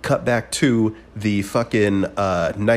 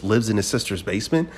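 usual speaking voice

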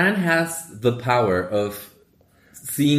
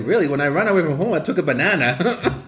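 put-on Fran's Queens accent, with a laugh; 6 semitones higher, faster than usual. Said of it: "she says it in a very Queens accent".

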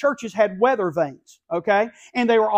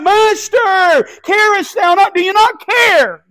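high, loud, pleading shout, slow on the last words; desperate cry; 11 semitones higher, 9 dB louder, slower than usual. Acted. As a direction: scream it out as the boat goes under. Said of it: "And so they cry out".

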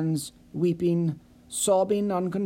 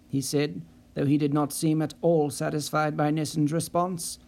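plain reading voice

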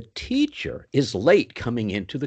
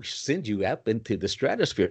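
ordinary talk, nothing marked

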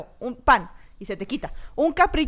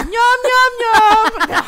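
mock munching; 9 semitones higher, 9 dB louder, much slower than usual. Mocking eating noise.

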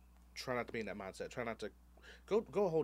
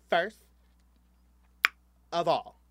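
loud, high, affronted queen; indignant queen; 7 semitones higher, 14 dB louder, slower than usual. Retold or imitated. The queen storming over in a loud, high, offended voice. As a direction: draw yourself up, highly offended. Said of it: "this queen was like".

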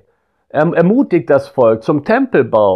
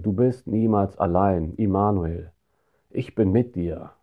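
much softer and lower, tender and intimate; hushed comfort; 5 semitones lower, 7 dB quieter than usual. Acted. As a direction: say it like a hand on the shoulder. Comforting lonely listeners.

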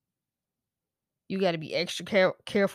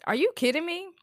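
pitched up high in stunned disbelief; incredulous; 9 semitones higher than usual. Real, not acted. Ranting to viewers, repeating the question in high disbelief. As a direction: ask it again, higher, not believing it.

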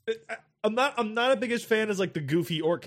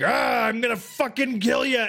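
higher, slow mock orc war cry; menacing orc; 5 semitones higher than usual. Old orc threatening the player, mock menace. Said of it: "they were a bit more just like".

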